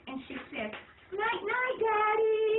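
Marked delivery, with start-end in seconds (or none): 1.1-2.6 put-on high, bright goodnight from a small girl; a tiny girl calling night night; little girl voice. A narrator's reading. Little sister says goodnight.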